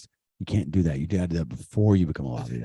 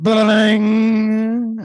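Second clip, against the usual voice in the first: high, drawn-out ba-da-ding; playful strummed chord, high and slow; sung guitar strum; 14 semitones higher, 7 dB louder, much slower than usual. Pretend. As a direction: sing out a guitar chord sound.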